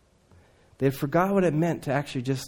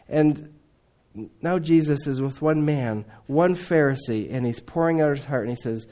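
ordinary delivery

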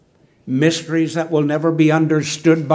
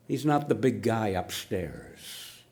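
normal talking voice

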